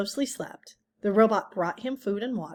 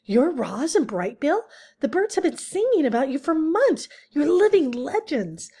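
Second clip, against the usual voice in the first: a star-struck little animal gushing; squeaky excitement; 7 semitones higher, 5 dB louder than usual. A narrator's reading. Skunk meeting her heroes, high excited squeak. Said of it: "the voice squeaked with excitement".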